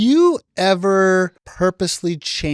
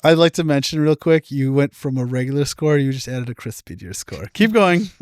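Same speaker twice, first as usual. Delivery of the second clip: ordinary delivery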